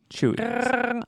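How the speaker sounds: high-pitched Wookiee noise; Chewbacca impression. Podcast co-host slipping in Wookiee noises, playful Chewbacca impression. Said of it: "where the Chewbacca noises come from".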